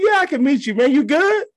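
playing it up, voice jumping high; incredulous squeak. To his cousin on the phone, squeaky disbelief.

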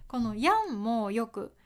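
ordinary delivery

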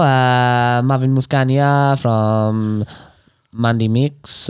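slow, hesitant, low mock French; drawn-out French accent with long uhs. Plugging a mobile mechanic, slow French accent. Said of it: "in French".

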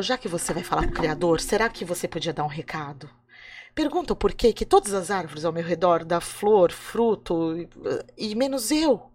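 a hint of a weary tree pleading; sad tree voice. A árvore fala com o moço. (árvore triste, voz um pouco mais grave e baixa)

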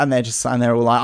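ordinary talk, nothing marked

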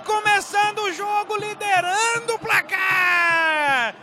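high, loud goal scream; explosive goal commentary. Real, not acted. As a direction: yell the goal call with everything. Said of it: "Desentalei o grito de gol".

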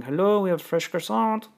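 a hint of a French receptionist; French accent. Hotel receptionist's wake-up call, in a light French accent. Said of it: "It's, like, some French accent".

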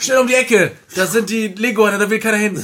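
high, loud getaway directions; excited urging. Acted. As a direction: urge the gang onward at a shout. Flucht mit dem Koffer. (aufgeregt und laut angetrieben)

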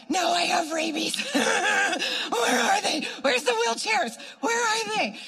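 high, loud, laughing overexcitement; hammed-up eagerness. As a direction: call out wildly like you're hunting for takers. Hammed-up eagerness for bigger healings.

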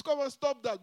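playful loud brush-off; mock protest, up high and loud. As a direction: wave it off loudly, half laughing. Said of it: "You say".

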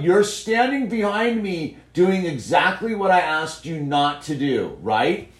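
stern scolding; firm fatherly telling-off; big dog voice. Real, not acted. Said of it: "the big dog voice".

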